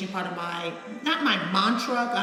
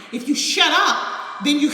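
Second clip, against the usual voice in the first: emphatic exhortation; 6 semitones higher, 9 dB louder than usual. Real, not acted. Emphatic punchline of her advice.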